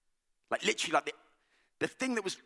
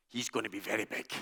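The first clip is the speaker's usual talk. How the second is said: imitating Scottish dad; 11 semitones lower, 10 dB quieter, faster than usual. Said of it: "he sounds like Shrek".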